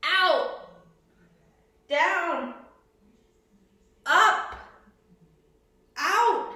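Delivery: loud high coach calls; shouting cues. Real, not acted. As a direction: bark out each move. Coach calling moves in class.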